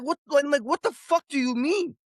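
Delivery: high-pitched incredulous outburst; exasperated, pitched well above normal; retold exasperation. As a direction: demand an explanation, voice jumping up. Retold angry exchange with airline staff.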